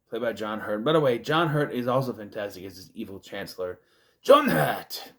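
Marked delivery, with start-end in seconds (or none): 4.2-5.1 louder mock cry of the name; playful, exaggerated shout-out; hammed-up exclamation. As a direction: blurt it out in a put-on voice.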